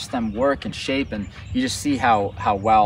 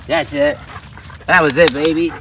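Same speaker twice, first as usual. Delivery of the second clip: louder, livelier praise; more excitement; 5 semitones higher, 6 dB louder than usual. Shown as a demonstration. Trainer offering the dog more excitement, louder praise. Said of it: "I'm offering a little more excitement".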